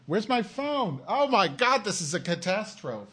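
high, panicked searching; frantic. Real, not acted.

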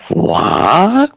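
shocked high gasp of what; stunned high what. Acted. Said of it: "I gasped".

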